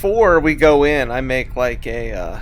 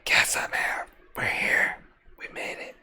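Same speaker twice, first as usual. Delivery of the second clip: soft whispered call; 9 dB quieter than usual. Acted. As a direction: breathe it out in a whisper.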